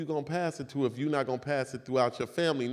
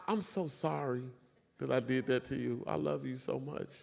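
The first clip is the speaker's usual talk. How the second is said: soft, sincere, quieter; tender apology; 7 dB quieter than usual. Real, not acted. Apologizing to his wife in the audience, soft and sincere. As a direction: speak gently to her.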